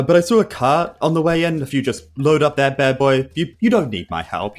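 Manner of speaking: usual speaking voice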